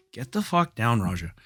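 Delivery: curt order off to the side; real aside command. Real, not acted. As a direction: break off to order someone down, dropping away on the name.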